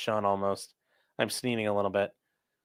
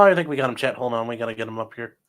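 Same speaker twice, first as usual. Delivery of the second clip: normal talking voice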